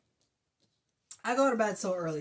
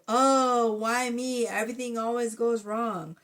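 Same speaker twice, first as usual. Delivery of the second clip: self-pitying whine; 4 semitones higher, 4 dB louder than usual. Retold or imitated. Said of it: "this energy of".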